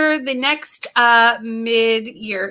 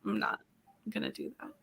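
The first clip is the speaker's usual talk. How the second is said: lazy refusal; 19 dB quieter, faster than usual. Retold or imitated. Vlogger retelling talking herself out of filming, low lazy mumble. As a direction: drop low and soft, dragging. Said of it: "And then I'm like".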